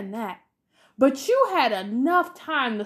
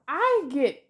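ordinary delivery

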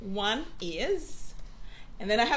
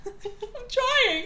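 laughing; 13 semitones higher than usual. Real, not acted. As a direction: squeeze it out through laughter. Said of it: "I am trying".

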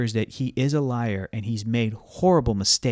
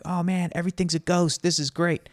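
higher-pitched excited little kids, imitated; imitated kid excitement, pitched up; 6 semitones higher than usual. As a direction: mimic thrilled kids in a raised pitch. Little kids' excited reaction voiced.

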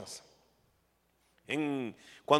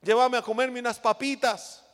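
put-on crying child; 6 semitones higher than usual. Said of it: "unos chiquitos ahí llorando y diciendo".

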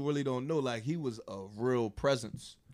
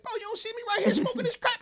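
much higher and laughing as Rich, incredulous; high mocking Rich voice; 5 dB louder than usual. Telling how Rich snapped at Jim Brown, in a high laughing voice. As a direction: squeak it out like a high-as-a-kite Rich, cracking up.

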